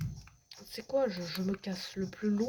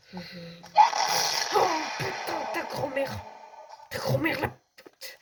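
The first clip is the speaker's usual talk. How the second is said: scared outburst; 4 semitones higher, 5 dB louder, slower than usual. Real, not acted. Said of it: "J'ai eu la peur de ma vie".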